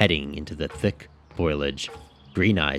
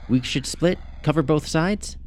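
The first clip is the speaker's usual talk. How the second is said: pinched nasal question; 11 semitones higher than usual. Acted. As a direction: speak through the nose, higher. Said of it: "her voice extra nasally".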